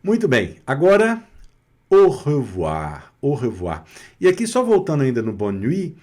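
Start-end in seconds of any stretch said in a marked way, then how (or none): 1.9-3.8 slow, lower-pitched model of "au revoir"; French demonstration. Shown as a demonstration. Teacher introduces the farewell to students, slowly demonstrated. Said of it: "aqui a consoante T não foi pronunciada".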